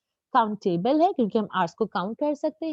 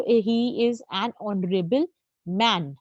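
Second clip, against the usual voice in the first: very slow example, each word set apart; slow example read; 3 semitones lower, much slower than usual. Shown as a demonstration. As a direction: lay it out slowly for students. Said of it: "teacher reads example sentence". (धीरे-धीरे उदाहरण पढ़ना)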